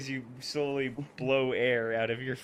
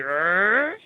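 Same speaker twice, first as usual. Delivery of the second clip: angry growl; 6 semitones higher, 5 dB louder than usual. Retold or imitated. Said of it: "Savo getting angry".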